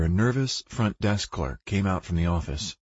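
ordinary delivery

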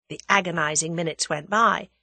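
usual speaking voice

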